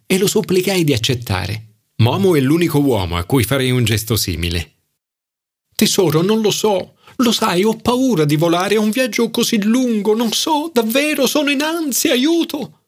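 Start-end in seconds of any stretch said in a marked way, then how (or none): 5.7-12.8 climbing higher and higher in mock panic; much higher, fretful cry for help; rising panicky squeal. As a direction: spiral up into shrill-pitched anxiety over flying. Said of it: "Iniziò ad alzare la voce fino a raggiungere i suoi soliti acuti".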